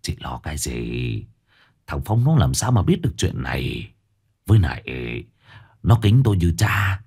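man's voice gentled, low and soft; softened voice. A narrator's reading. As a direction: soothe her in a low voice. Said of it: "ông Cửu dịu giọng".

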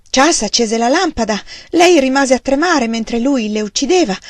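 plain reading voice

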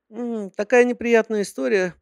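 put-on high girly secretary voice; sweet, high-pitched young secretary; cartoon princess. A narrator's reading.

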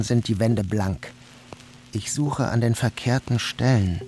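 ordinary delivery

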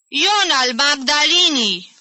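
high, loud, drawn-out yell; yelled explanation. A narrator's reading. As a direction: yell it slowly for someone hard of hearing. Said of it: "lămuri țipând tanti Mali".

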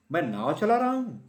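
high, loud, gleeful cry; little mouse shouting with joy in his hat-boat; excited shout. A narrator's reading. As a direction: crow with delight at sailing. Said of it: "Simon चिल्लाया".

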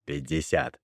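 a touch of a low-voiced haggling buyer; merchant buyer. A narrator's reading. Merchant names his price.

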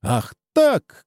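high, defiant cry; shouted retort. A narrator's reading. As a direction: fire back in a lifted voice. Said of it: "крикнул Чеба". (задиристый выкрик)

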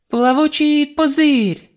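very high, slow, loud spell call; spell shout. A narrator's reading. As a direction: stretch out the spell name loudly. Reimi casts a spell.

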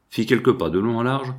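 ordinary delivery, nothing marked

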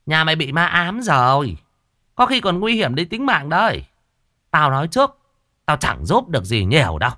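high, loud, bossy warning; stern shaman. Acted. Shaman warning the old woman of ghosts, high and bossy. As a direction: talk down to the client.

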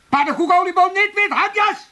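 loud, high volley of insults; pent-up outburst. Acted. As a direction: explode once he is out of earshot. Bursts out once he has gone.

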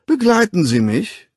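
high, loud honeyed call; sweet, changed tone. A narrator's reading. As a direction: switch to charming suitor. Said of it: "rief er in völlig verändertem Ton".

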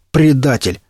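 yelling. A narrator's reading. Пригоршня accuses William of betrayal, mild yell. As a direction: throw the accusation at him. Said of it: "заорал на него Пригоршня".

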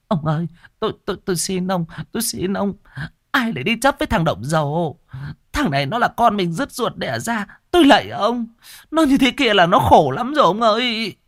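high, tearful pleading wife; pleading mother. A narrator's reading. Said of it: "vội vàng chạy lên can ngăn chồng". (người vợ van xin chồng, giọng cao)

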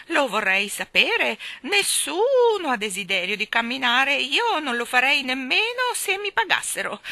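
put-on high, loud squawking old lady, slow at the edges; shrill hen-like shriek. Miss Calico scolding the children in a shrill, high, loud squawk. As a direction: screech it out like a hen. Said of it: "con uno strillo acuto come quello di una gallina".

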